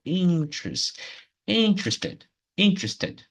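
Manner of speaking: "interested" with a high IN and a falling end; stress on IN. Shown as a demonstration. Teacher modelling the similar word, "interested" stressed on IN. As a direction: lift the first syllable of "interested" sharply. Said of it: "mais ou menos a mesma coisa".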